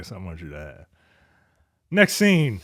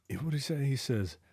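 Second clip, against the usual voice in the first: ordinary talk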